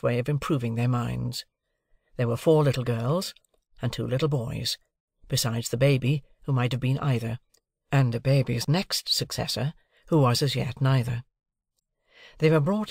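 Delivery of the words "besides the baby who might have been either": usual speaking voice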